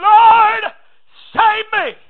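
high, loud desperate cry for help; belted, drawn-out cry to the Lord. Retold or imitated. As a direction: preach it as a drowning man's shouted plea. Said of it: "He cried, saying".

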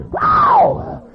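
very high, loud whoop; ecstatic preacher's holler. Real, not acted. Preacher at the peak of his story, piercing holler. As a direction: let out one long piercing cry.

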